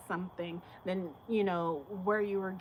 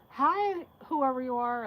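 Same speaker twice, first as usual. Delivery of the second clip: high, loud, overeager mock greeting; mock pushy greeter; 5 semitones higher, 7 dB louder than usual. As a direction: chirp it up high like someone forcing a hello. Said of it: "They'll be like".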